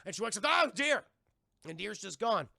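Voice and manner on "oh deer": higher, louder startled wake-up call. Retold or imitated. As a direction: call out in sudden alarm. Tulip wakes, deer gone.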